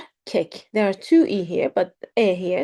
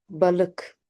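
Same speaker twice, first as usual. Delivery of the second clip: ordinary talk, nothing marked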